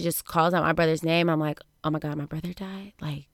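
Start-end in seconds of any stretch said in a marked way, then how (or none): 1.8-3.3 quiet, stunned disbelief; hushed retelling of dazed shock. Retold or imitated. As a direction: say it softly, as if in shock. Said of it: "that first state of shock".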